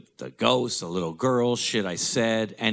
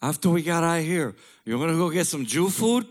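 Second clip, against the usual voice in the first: put-on high, louder old dad voice; imitating dad; 9 semitones higher, 4 dB louder than usual. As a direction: play the dad cheerfully asking loudly.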